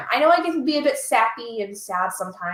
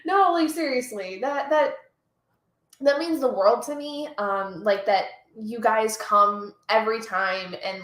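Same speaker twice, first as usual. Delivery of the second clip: normal talking voice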